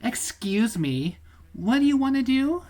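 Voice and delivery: high, loud put-on woman voice. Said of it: "best stereotypical woman voice".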